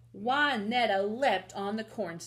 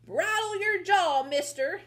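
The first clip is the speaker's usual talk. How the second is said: high, loud ranch-mother scolding; fierce, commanding cowgirl mother; defiant shout; 10 semitones higher, 6 dB louder than usual. Acted. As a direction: holler it up at the giant. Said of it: "she shouted".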